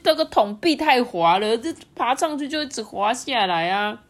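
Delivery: high, loud whimpering complaint; hammed-up tearful frog; whiny frog. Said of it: "第一只青蛙哭丧脸说".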